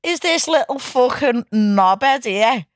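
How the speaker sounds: who the hell is this, offended; outraged disbelief. Real, not acted. Reacting to an insulting clip.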